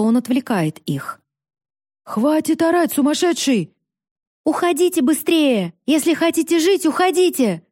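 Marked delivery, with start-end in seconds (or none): 2.0-3.7 higher-pitched heckle from the crowd; irritated scolding. A narrator's reading. Villager scolds the shouting father. 4.4-7.6 put-on high, thin boy's voice urging people to flee; thin-voiced cry. A narrator's reading. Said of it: "крикнул тонким голосом Юстин".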